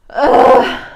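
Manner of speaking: loud, high groan; exasperated groan. Real, not acted. Vlogger fed up late at night, exasperated groan. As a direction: let out a loud "ugh". Groan before washing dishes.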